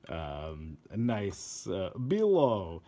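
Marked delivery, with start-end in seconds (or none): 2.0-2.8 "Bilo" stretched slow and louder; mock Russian name. Shown as a demonstration. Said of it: "Let's go with Russian name".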